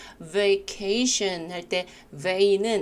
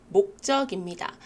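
ordinary talk, nothing marked

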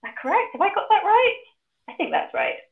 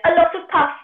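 high, louder mocking pig voice; cheeky piggy; 11 semitones higher, 6 dB louder than usual. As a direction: tease the wolf in a high little pig voice. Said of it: "pig said".

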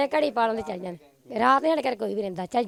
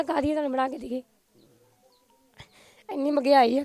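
tearful, voice rising higher; real crying; 3 semitones higher, 4 dB louder than usual. Real, not acted. Mother telling of answering her daughter amid high prices, crying. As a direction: break into tears, louder as it goes. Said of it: "ਰੋ ਨਾ".

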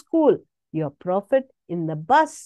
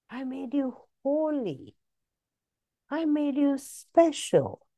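tender affirmation; 5 dB quieter than usual. Acted. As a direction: tell them gently who they are. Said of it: "slow soft tender affirmations".

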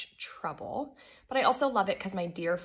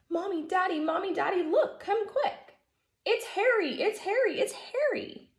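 put-on children shouting with joy, much higher; excited children shouting; 11 semitones higher than usual. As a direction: call out like thrilled kids who found their dog. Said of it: "they began shouting".

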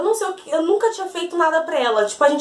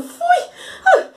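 laughing through it. Real, not acted. Admits being a coward, laughing.

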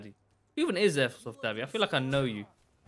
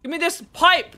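slow loud demand; 13 semitones higher, 10 dB louder, slower than usual. Real, not acted. Demanding the weapon in-game.

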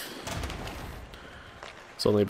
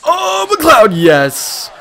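high, loud shout of joy; excited goal cheer; 8 dB louder, much slower than usual. Real, not acted. Gamer cheering teammate's tying goal, excited shout. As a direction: yell it out as the puck goes in.

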